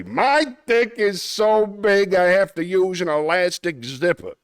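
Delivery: cartoon Brooklyn wiseguy accent; Brooklyn tough guy. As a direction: boast it like a Looney Tunes Brooklyn tough guy. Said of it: "Yes, it's a Brooklyn accent".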